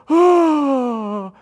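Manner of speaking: a loud, high, awestruck "Oh"; awed gasp. Retold or imitated. Kids at the gate gasping in awe. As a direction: gasp like seeing heaven.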